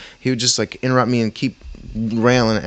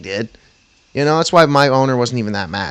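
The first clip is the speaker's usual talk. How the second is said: usual speaking voice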